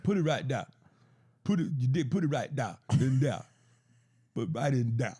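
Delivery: lightly voiced pushy Southern accent; aggressive Southern accent. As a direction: bark it in a mild mock Southern accent. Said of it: "an aggressive Southern accent that you're doing".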